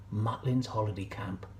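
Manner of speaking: usual speaking voice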